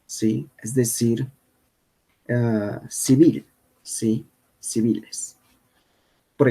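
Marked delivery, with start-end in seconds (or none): none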